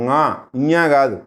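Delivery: slow ঙా through the nose, not ఇඤా; correct nasal sound. Shown as a demonstration. Teacher correcting the wrong reading ఇඤా to ঙా. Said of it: "అది ঙా అని చదవాలి".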